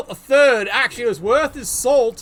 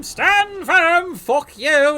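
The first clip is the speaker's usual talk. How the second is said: loud defiant battle cry; shouted character voice; 6 semitones higher, 5 dB louder, much slower than usual. Acted. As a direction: bark it like a stubborn warrior. Voicing the player on pitch.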